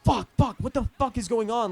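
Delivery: high, loud burst of alarm; retold panicked cursing. Driving to girlfriend's house, retold panicked cursing. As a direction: curse in a replayed panic.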